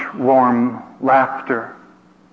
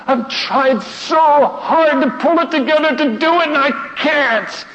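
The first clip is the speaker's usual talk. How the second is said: crying out that he cannot do it; anguished plea; 11 semitones higher than usual. Retold or imitated. Speaker confessing his failure, anguished plea. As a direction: pitch climbing high, voice straining. Rising anguish in retold struggle.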